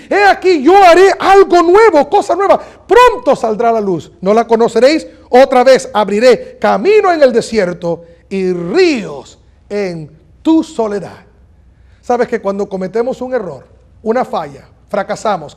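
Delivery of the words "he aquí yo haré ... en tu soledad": proclaiming scripture. Real, not acted. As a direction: herald the verse, then stretch out each phrase. (proclamación bíblica enfática)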